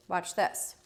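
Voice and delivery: normal talking voice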